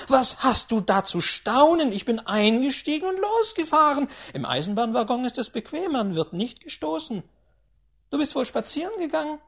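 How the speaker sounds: put-on high, loud, domineering old lady berating; a rich old tyrant who brooks no nonsense; imperious grandaunt. A narrator's reading. Grandaunt scolding the tutor and her steward, high and commanding.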